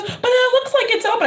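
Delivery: very high imitated kid voice; mock childish protest. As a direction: argue back in a high little-boy voice. Child protesting in retold exchange.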